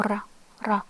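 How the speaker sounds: "ra" with a Spanish-style tongue-tip r. Shown as a demonstration. Teacher showing the third letter, "ra" with Spanish R. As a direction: tap the r in "ra" as in Spanish. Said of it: "Spanish R".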